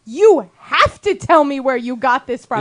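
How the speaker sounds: slow, deliberate mimicry of an official; insistent demand. Imitating the dump worker repeating her demand, slow insistence. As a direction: press each word, stubbornly. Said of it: "And she's going".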